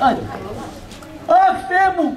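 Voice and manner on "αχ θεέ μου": high, put-on lament; mock mourning wail. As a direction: wail for a husband who isn't dead. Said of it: "δεν μπορεί να με κλάψεις". (ψεύτικο ψιλό μοιρολόι)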